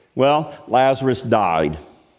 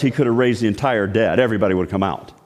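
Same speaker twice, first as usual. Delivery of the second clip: ordinary delivery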